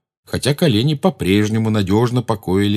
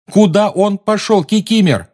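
higher, louder shout; 12 semitones higher, 6 dB louder than usual. Acted. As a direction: yell the question again. Repeated question, escalating.

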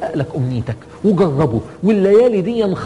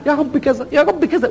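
insistent pleading; 8 semitones higher than usual. Retold or imitated. Persistent worshipper nagging God.

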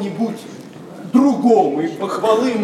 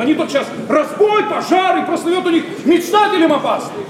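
high, raised cries of scandal; mock alarm; 7 semitones higher than usual. Young man mimicking the old guard's panic, high mock alarm. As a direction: pitch it up like panicked accusers.

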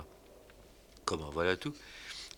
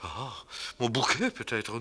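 ordinary delivery

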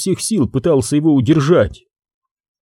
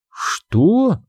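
surprised; 8 semitones higher than usual. Acted. Zahara surprised.

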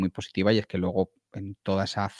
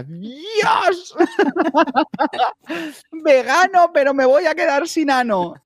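mock pained yell; 8 dB louder, slower than usual. Biting into very spicy food.

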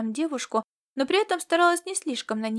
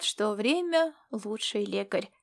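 plain reading voice

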